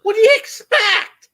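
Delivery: loud high exclamation; laughing mock exasperation; exclaiming. Real, not acted. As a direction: throw up your hands and shout it. Teasing friend about Santa.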